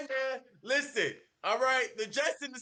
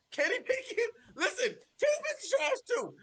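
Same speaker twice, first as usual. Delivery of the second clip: high laughing rant; 12 semitones higher than usual. Real, not acted. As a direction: laugh through the words. Laughing while trashing Pickett.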